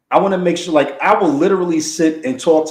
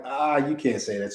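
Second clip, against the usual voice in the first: much softer self-warning; inner voice; 9 dB quieter than usual. Retold or imitated. Husband warning himself not to hurt his wife, softly. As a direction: hush yourself, inner voice. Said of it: "I'm like".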